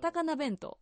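「高菜弁当」 high, with Osaka melody; Osaka intonation. Shown as a demonstration. Host repeats the bento name for her colleague, Osaka intonation. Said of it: "私が大阪で多分聞いてるのは".

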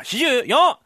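loud eager call; shouted answer; shouted age. Acted. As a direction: blurt the number out. Clerk shouts age as taught.

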